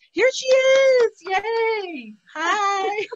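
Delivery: very high, loud happy greeting; excited. Real, not acted. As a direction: cheer as a friend reappears. Partner returns to the call.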